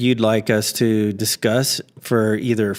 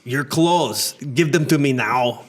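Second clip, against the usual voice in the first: put-on Austrian-accented action-hero warning; thick Arnold-style accent; Terminator. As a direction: play an Austrian movie cyborg. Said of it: "to the Terminator".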